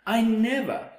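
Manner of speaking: high, proud declaration. A narrator's reading. North-going Zax refusing to move, proudly. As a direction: puff it up with pride. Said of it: "puffed his chest up with pride".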